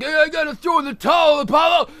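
high-pitched imitated shout to a fighter; Rocky shouting. Rocky at ringside tells Apollo about the towel, shouting. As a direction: call out as the worried cornerman. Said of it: "he's like".

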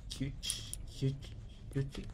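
soft, tentative tries at "chuch, chuchi"; "chuchi" tried out softly, unsure how it goes; unsure pronunciation. Real, not acted. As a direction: sound out "Chuch, chuch, chuchi" quietly and slowly. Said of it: "no sé cómo se pronuncia".

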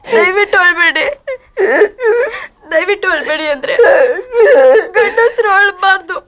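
high, crying plea not to weep; begging him through her own tears; wife sobbing as she begs her husband to stop crying; tearful pleading. Acted.

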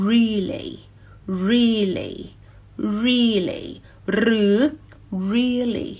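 "really" with r onset and ri-li; clear English really. Shown as a demonstration. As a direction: say "really" with a real r. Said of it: "เอา really มาช่วย".

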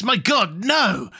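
"My God, no" pitched high, with a falling "no"; extraordinary emphasis. A narrator's reading. The voice refusing a boat for the lady, "no" very emphatic. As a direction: exclaim it in high horror, hitting "no" hard. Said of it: "with extraordinary emphasis".

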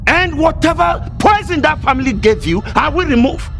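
high, forceful declaration; heated, determined outburst; angry vow. Acted.

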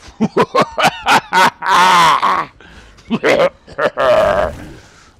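loud growling zombie roar. Acted. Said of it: "That's a sort of vom-, zombie voice".

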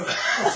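loud burst of laughter; hearty laugh. Real, not acted. Laughing after the kids' cry.

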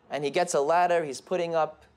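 ordinary talk

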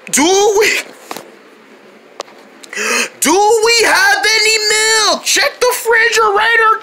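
high, panicked cries for help; frantic emergency. Acted. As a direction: holler urgently over the screaming. Frantic hunt for milk to help.

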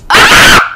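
short sharp scream of real fright; sudden loud cry of shock; startled scream. Real, not acted. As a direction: yelp as something jumps out at you. Startled by a jump scare.

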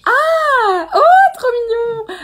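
high, loud, drawn-out cry of delight; slow, loud gushing over a pet ferret; delighted squeal. Real, not acted. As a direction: squeal at how cute it is. Learns Maui is a pet ferret.

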